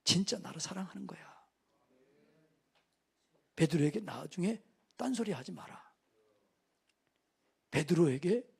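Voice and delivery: quiet, low, firm admonition; soft warning. Acted. Jesus telling villagers not to mock Peter, quiet firmness. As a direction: warn them gently but firmly.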